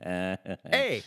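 a drawn-out Canadian "A." as the tag word; demonstrated Canadian eh. Shown as a demonstration. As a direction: say "A." like a stock Canadian. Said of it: "trademark Canadian word".

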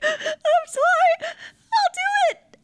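squeaky, near-crying apology; mock tearful whimper. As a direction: beg apologetically as if sobbing.

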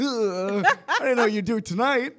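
a pained ugh at the surprise; dismayed. Retold or imitated. Watching a friend do the joke onstage, mock dismay.

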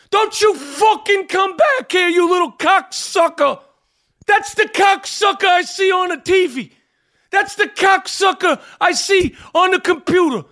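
put-on angry old Brooklyn pizza man yelling; furious pizzeria owner shouting. Sal character rant.

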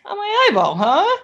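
high, mocking "on my eyeball, huh?"; sarcastic disbelief. Sarcastic reply to tumor news.

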